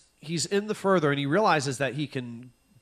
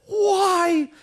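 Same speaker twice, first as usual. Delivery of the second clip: much higher and louder, wailing; anguished cry; 7 dB louder than usual. Retold or imitated. Reviewer lamenting the movie, high loud wail. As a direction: throw the word up in despair. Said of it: "And I'm like".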